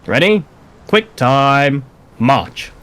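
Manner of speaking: very slow, drawn-out parade call; drill command. Shown as a demonstration. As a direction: stretch every word like a sergeant. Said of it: "when I state march is when you move".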